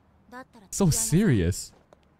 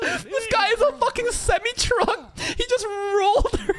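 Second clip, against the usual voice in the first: excited laughing shout. Real, not acted. Laughing at a big tackle.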